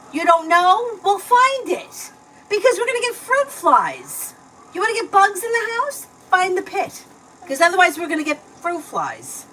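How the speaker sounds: high, loud demand to find the pit; impatient scolding. Real, not acted. Mom demanding child find plum pit.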